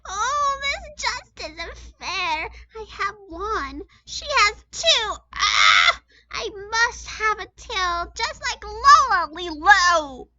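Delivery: a bird wailing that life isn't fair; jealous girl bird shouting. A narrator's reading. Gertrude wailing about Lola's tail, high loud whine. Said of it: "she shouted".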